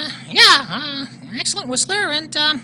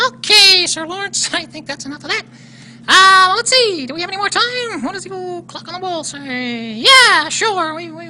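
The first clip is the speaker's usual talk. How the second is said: usual speaking voice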